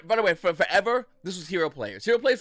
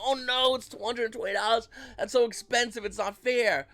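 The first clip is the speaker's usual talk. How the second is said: higher-pitched mock panic; mock freak-out; 6 semitones higher than usual. Buyers reacting to a shoe price jump, mock freak-out. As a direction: act out an overblown fret over a price. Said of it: "Everyone's freaking out".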